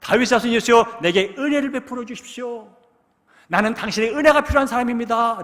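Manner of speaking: loud, high pleading shout; desperate cry. Retold or imitated. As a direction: cry out to be heard over the crowd. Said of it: "소리를 칩니다".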